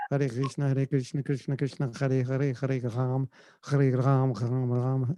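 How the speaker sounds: low, soft chant with French r's; French accent. Shown as a demonstration. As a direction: chant it as a French devotee would. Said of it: "That's the French pronunciation of".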